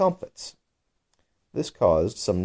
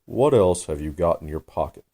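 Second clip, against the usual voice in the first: gravely. A narrator's reading. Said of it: "the dodo replied very gravely".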